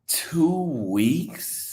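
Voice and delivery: incredulous whisper. Real, not acted. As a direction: breathe it in quiet disbelief. Muttering the disbelief again.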